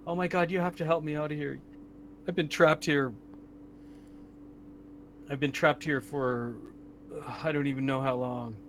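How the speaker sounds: a hint of a trapped older woman; lightly voiced older woman. Game master voices older woman trapped in tank, understated plea. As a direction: lightly play an older woman pleading to get out. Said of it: "it sounds like an older woman".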